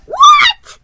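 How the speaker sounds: very high, loud shrieked what; piercing shocked shriek. Acted. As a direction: shriek it in disbelief. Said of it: "he shrieked".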